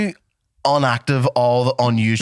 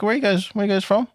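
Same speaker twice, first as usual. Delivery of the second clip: higher-pitched curious question; quoted Danish stranger; 12 semitones higher than usual. Retold or imitated. Host retelling a Danish person's question, quoted stranger voice. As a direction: ask in a raised, inquiring voice. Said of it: "a Danish person was like".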